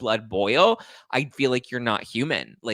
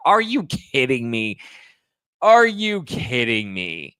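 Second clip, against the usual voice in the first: loud, drawn-out incredulous outburst; outraged disbelief; 6 dB louder, slower than usual. Real, not acted. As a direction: stretch each word in loud indignation. Outraged reaction to article he reads.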